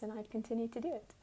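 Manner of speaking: ordinary talk, nothing marked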